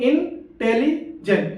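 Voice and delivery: Intelligent as three slow beats, in-teli-jent; split in three. Shown as a demonstration. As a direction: run te and li together in intelligent, gaps around them. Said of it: "तीन बार पर".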